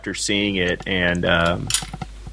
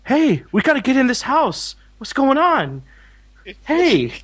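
much higher, hammed-up Mark Wahlberg line reading; Mark Wahlberg impression. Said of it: "greatest Mark Wahlberg line readings ever".

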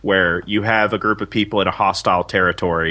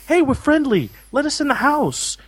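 much higher hammed-up Mark Wahlberg voice; Mark Wahlberg impression. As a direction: plead sweetly as Mark Wahlberg. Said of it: "Mark Wahlberg's like".

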